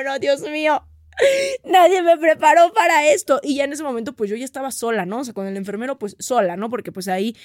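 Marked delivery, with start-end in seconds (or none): 0.0-3.4 tearful, rising, louder plea; frightened mother in labour, sobbing; panicked crying. Retold or imitated. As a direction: cry out in fear. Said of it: "y yo".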